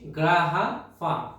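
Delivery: গ্রাহাফা drawn out very slowly; slow repeat. Shown as a demonstration. Teacher repeating গ্রাহাফা slowly for learners. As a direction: say গ্রাহাফা again, slowly and clearly. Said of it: "আবারও বলছি".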